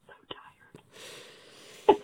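exhausted soft whisper; tired whisper. Real, not acted. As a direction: whisper it, worn out. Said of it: "I'm tired".

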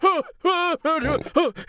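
high, short panicked screams; panicked screaming. Acted. Old man tied in his chair, high panicked screaming. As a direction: scream in bursts like someone freaking out. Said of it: "starts screaming".

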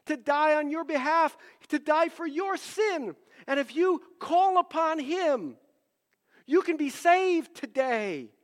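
retold earnest plea to a phone scammer; urgent pleading. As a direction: plead with him like his soul depends on it. Preaching to the caller.

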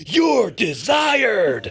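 high, slow booming announcement; Vince McMahon shout. Pretend. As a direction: bellow it like a wrestling boss.

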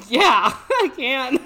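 loud, drawn-out yeah breaking into laughter; high, slow quoted answer that cracks up; amused retelling. Retold or imitated. Retelling her prank-call answer, laughing loudly. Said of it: "I was like".

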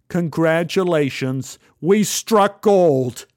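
swelling jubilant proclamation; excited. A narrator's reading. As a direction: read the good news out big and slow. Reading thrilling letter aloud, amazed.

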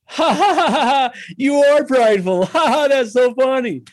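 high, loud mocking laughter through the words; hearty teasing laugh; wife laughing at him. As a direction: cackle with delight at his confession. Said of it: "She goes".

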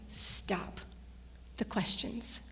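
soft, breathy stop; hushed command. Real, not acted. Preacher urging listeners to stop doubting, in a whisper. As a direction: hush them to a stop.

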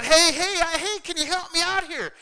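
loud, high-pitched acted holler; hollering for help. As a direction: call out urgently from down a hole. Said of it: "and he hollers out".

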